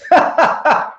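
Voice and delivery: high-pitched burst of laughter; high ha-ha-ha on demand; demonstrated laugh. Shown as a demonstration. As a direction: put on a big high laugh. Demonstrates forced phone laughter.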